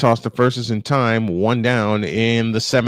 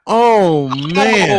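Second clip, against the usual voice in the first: higher, louder pained groan of sympathy; sympathetic wince; 7 semitones higher, 5 dB louder than usual. Real, not acted. Broadcaster reacting to kneecap hit, real sympathetic wince. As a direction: wince for the player out loud.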